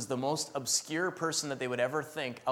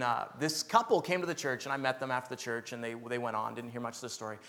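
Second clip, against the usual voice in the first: normal talking voice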